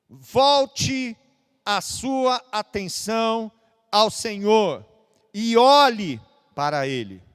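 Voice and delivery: loud, slow preaching cry; impassioned slow appeal; fervent call. Real, not acted. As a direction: call them back with force. Preacher calls congregation to God.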